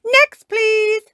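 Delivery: very high, loud call; shout. Acted. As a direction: call out to a queue in a shrieky high voice. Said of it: "comes another shout".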